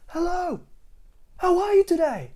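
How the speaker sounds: very high, loud cheerful yelling; high shouted greeting. Acted. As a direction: holler hello down the street. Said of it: "They'd shout as they met".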